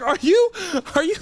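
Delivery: high, loud, halting disbelief; incredulous. Retold or imitated. Pranked customer to his friend CJ, incredulous outburst. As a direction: stammer it out, rising in outrage.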